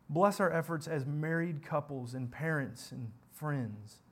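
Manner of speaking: steady, measured congregational prayer; leading a prayer. Acted. Pastor praying for his congregation, in a measured prayer voice. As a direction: pray aloud for the church, unhurried.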